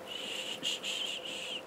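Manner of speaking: soft unvoiced shushing; soothing shush. Real, not acted. Mother soothing sick child.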